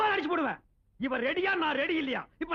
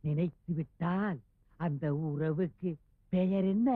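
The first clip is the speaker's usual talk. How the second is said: low, slow, with pauses between phrases; word by word; 12 semitones lower, slower than usual. Acted. Repeats question word by word. (தாழ்ந்த குரலில் நிறுத்தி நிறுத்திக் கேட்பது)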